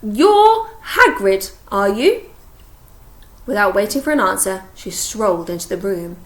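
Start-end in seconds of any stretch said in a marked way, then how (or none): 0.0-2.2 very slow, high, loud, over-pronounced. Acted. Umbridge to Hagrid, slow loud condescending words. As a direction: talk as if he were deaf. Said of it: "she said slowly and loudly".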